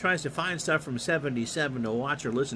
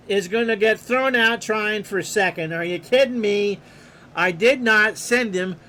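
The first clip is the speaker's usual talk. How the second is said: louder, incredulous protest; exasperated outburst; 5 semitones higher, 6 dB louder than usual. Real, not acted. Player upset his runner went without being sent, exasperated. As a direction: vent at the runner who ran on his own.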